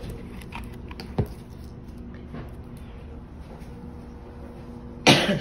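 hard coughing from the Lysol spray; coughing fit. Real, not acted. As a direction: cough it out for real, a few seconds. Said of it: "And I'm coughing from it".

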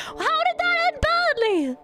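shocked squeaky question; high surprised question. Real, not acted. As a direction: pitch it way up in disbelief. Surprised reaction to bad ending.

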